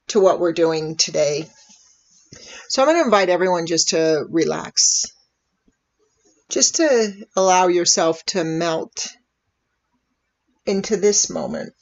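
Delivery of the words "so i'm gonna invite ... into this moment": calm, unhurried meditation voice with long pauses; soothing, measured invitation to breathe and settle; guided meditation. Real, not acted. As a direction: gently guide listeners into relaxing, leaving space after each phrase.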